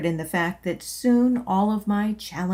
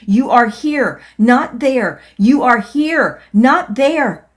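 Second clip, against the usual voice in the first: much louder, higher, insistent chant; loud proclaiming; 4 semitones higher, 10 dB louder, slower than usual. Acted. Pounding his hips, loudly telling himself a much louder insistent chant. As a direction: pound it home with each word. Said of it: "as I loudly proclaimed".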